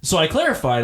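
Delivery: ordinary delivery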